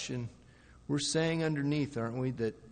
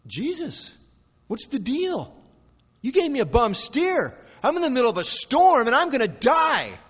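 high protest building to a loud cry; betrayed and confused; 11 semitones higher, 9 dB louder than usual. Acted. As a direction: complain like someone let down, growing louder. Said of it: "feeling a little bit betrayed by Jesus".

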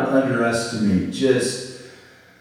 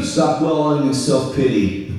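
normal talking voice

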